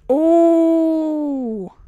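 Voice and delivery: long, loud high "oh"; drawn-out yelp that falls at the end; startled cry. Real, not acted. Player startled inside enemy base, long startled cry. Startled during stealth game.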